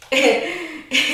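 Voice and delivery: brief giggle before going on; light laugh; real chuckle. Real, not acted. Laughs while telling her story.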